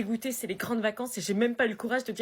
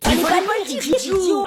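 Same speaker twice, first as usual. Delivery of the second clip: scolding shout; 9 semitones higher, 9 dB louder, slower than usual. Acted. Scolding classmate.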